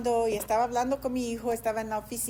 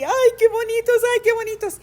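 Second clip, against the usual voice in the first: much higher and louder gushing; delighted squeal; 11 semitones higher, 11 dB louder than usual. Retold or imitated. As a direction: squeal over the cute puppies. Reír de gozo con los perritos. (chillido de gozo, mucho más agudo y fuerte)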